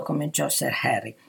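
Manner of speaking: plain reading voice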